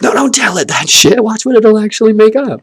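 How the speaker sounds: high, loud delighted urging; excited marvel. Retold or imitated. Watching a child's brain at work, high and thrilled. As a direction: cheer them on like a thrilled kid-watcher. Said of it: "It's like".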